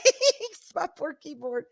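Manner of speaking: laughing. Real, not acted. Defending her loud keyboard.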